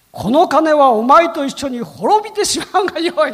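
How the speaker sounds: loud, high stern outburst with a laugh in it; stern rebuke. Retold or imitated. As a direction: thunder the curse at him, half laughing. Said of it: "一喝してます".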